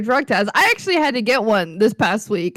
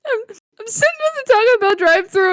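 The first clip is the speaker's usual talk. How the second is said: high, tearful imitation of a friend; exaggerated weepy voice; mock crying; 10 semitones higher than usual. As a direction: whimper it out, hammed-up. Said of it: "why are you crying".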